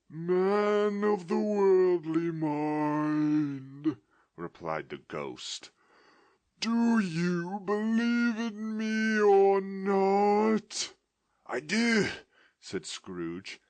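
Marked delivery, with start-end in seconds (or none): 0.1-4.0 very slow, high, loud spectral wail; ghost's voice. Acted. Ghost demanding an answer from Scrooge, slow wail. 6.6-10.9 very slow, high, loud spectral wail; ghost's voice. Acted. Ghost demanding an answer from Scrooge, slow wail. 11.5-12.1 high, loud frightened answer; Scrooge's voice. Acted. Scrooge answering the ghost in fear.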